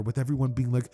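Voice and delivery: ordinary delivery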